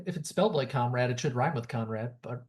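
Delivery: ordinary talk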